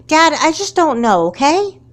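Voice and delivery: frustrated outburst; exasperated. Acted. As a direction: snap back at dad. Daughter snapping at dad.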